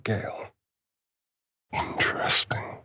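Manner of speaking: intrigued whisper; a secret, curious whisper. Acted. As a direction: breathe it out in a hush. Examining the hag staff.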